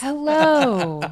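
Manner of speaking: high, bright hello; creepy chipper greeting; customer service voice. Acted. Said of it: "your customer service voice".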